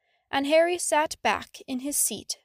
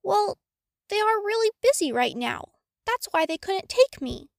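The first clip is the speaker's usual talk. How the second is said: mild nervous lie; 7 semitones higher than usual. Acted. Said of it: "Harry lied again".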